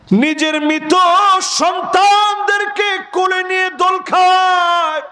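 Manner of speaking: high, drawn-out chant that sinks at phrase ends; melodic sermon lament. Acted. Preacher mourns fathers carrying dead children, melodic lament.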